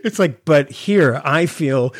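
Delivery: usual speaking voice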